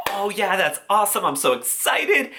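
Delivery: high, gushing self-quote; over-the-top giddy, high pitch; mock excited. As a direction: squeal it like you just got paid. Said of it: "And I was like".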